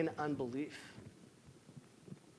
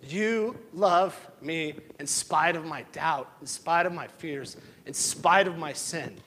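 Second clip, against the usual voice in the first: preaching at full force; 3 semitones higher, 7 dB louder than usual. Acted. Impassioned prayer of grace.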